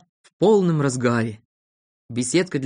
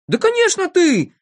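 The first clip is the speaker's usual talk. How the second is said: very high, loud chorus voice; emphatic high yell; accusing shout; 13 semitones higher, 5 dB louder than usual. A narrator's reading. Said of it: "закричали тут все".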